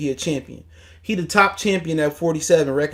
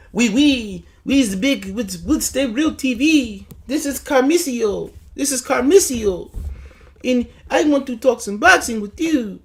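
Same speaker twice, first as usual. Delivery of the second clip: mock French accent; 7 semitones higher than usual. Mocking the YouTuber as a fake French host, French accent. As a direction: ham up a fake Paris accent. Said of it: "switch his accent".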